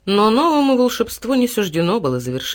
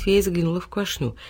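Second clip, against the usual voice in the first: usual speaking voice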